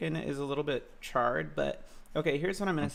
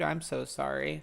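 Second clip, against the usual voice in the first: normal talking voice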